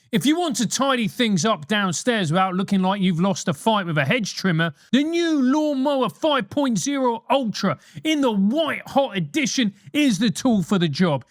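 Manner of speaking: ad read. Acted. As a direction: sell it like a cheeky radio advert.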